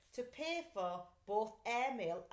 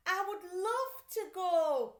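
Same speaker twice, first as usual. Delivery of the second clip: high, slow, louder eager plea; eager boy; 9 semitones higher, 9 dB louder, slower than usual. A narrator's reading. Boy begging to visit his friend, high and slow. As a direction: stretch it out longingly. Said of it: "Stanley said".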